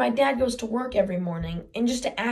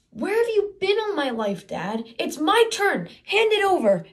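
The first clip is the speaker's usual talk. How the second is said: bratty whine; 8 semitones higher than usual. Retold or imitated. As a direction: whine it like a spoiled teenager. Mocking bratty teen demand.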